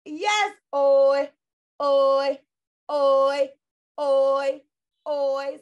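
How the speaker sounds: oi said loud and clipped, repeated; model sound oi. Shown as a demonstration. Phonics teacher drilling the class on oi, loud and clipped. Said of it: "what sound does O and Y make".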